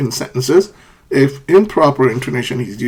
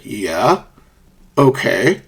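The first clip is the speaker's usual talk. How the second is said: "Yeah" and "okay" each rising; questioning lift on "Yeah" and "okay"; upward intonation; 3 semitones lower, much slower than usual. Shown as a demonstration. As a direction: start "Yeah" low and swing it upward, same for "okay". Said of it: "upward intonation in short response".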